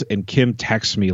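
ordinary talk

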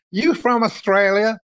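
high-pitched imitation of a girl asking; curious girl, lifted well above own pitch; drugstore girl. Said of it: "the girl would say".

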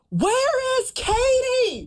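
high, slow, drawn-out call down a hall; drunk friend calling out. Retold or imitated. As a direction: stretch her name out like a tipsy friend searching. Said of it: "calling my name down the hall".

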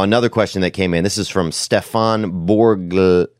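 ordinary talk, nothing marked

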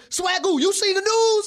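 loud, high excited holler across the office; hollering excitedly. Retold or imitated. Hollering the breaking news to Marcus Spears across the studio, loud and high. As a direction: call out the big news at full voice. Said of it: "I'm running in there hollering at Marcus Spears".

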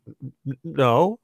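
small, soft stammer into a no; pitiful stammer. Retold or imitated. Poor kid answering the bigwig, imitated pitiful stammer. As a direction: imitate a timid, stammered denial. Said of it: "just really, really pitifully".

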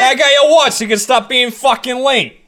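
mock Jersey accent, slowly. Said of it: "the year of the Jersey return".

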